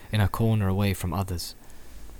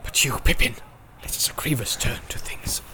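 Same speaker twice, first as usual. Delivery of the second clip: hushed wizard whisper; 3 semitones higher than usual. Acted. Gandalf in alarm.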